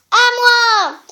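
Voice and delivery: loud high battle cry; shouting. Acted. Oedipus still shouting mid-fight, loud. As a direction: yell for help. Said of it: "hurlant toujours".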